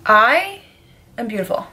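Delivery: high, playful self-praise; mock proud. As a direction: announce it like a diva. Joking self-compliment after scary.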